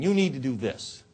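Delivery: pointed "You", pressed on the listener; finger-pointing. Shown as a demonstration. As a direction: order them about, a bit emphatically. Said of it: "with the finger pointing".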